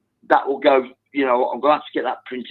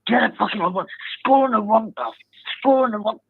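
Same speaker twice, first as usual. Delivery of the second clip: retold screaming; 9 semitones higher than usual. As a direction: replay the terrace scream in a pitched-up voice. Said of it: "I was screaming at him".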